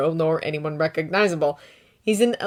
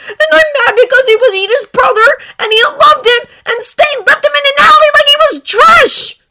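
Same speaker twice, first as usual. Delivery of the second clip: much higher, louder, sobbing through it; angry tears pouring out; crying anger; 16 dB louder than usual. A narrator's reading. Izuku crying in anger about Ingenium.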